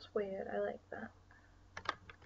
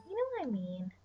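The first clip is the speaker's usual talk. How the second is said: higher playful repeat of the line; silly put-on voice; 4 semitones higher than usual. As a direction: say it again in a goofy voice.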